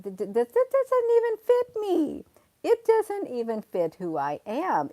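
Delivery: loud, high-pitched indignant rebuttal; indignant outburst. Real, not acted. Streamer rejects a commenter's claim that she talks defeat, indignant. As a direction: stammer into a high, loud protest.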